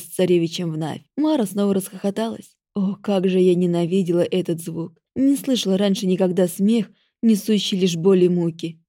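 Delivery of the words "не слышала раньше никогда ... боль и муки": ordinary delivery, nothing marked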